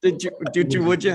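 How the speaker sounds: "did you, did you, would you" slurred to "didja, didja, wouldja"; Southern run-together. Shown as a demonstration. Host repeating the coined word as "didja wouldja". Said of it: "we tend to run all of our words together".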